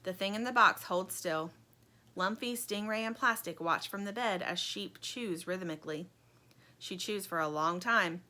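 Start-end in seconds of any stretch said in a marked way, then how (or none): none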